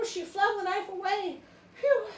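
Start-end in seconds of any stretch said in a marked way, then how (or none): none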